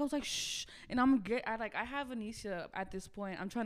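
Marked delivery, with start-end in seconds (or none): 0.2-0.7 long hissed "shh"; retold shushing. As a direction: imitate hushing a noisy friend. Hushing a screaming friend, retold.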